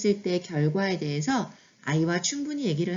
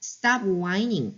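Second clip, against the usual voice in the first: each word stretched out very slowly; very slow demo; much slower than usual. Shown as a demonstration. Teacher showing a phrase parents often say, very slow. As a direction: say it at half speed so it sinks in. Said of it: "그때".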